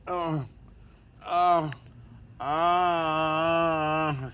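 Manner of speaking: high, loud hammed-up moaning; mock moans. Pretending to moan for the video, loud and high. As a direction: moan over the top. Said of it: "it's just me moaning".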